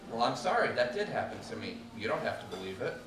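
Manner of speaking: ordinary delivery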